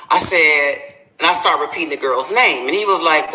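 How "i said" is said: ordinary talk, nothing marked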